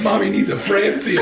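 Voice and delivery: high, laughing, sing-song mom; mom voice bit. Pretend.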